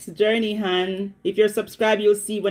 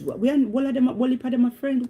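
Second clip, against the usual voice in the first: usual speaking voice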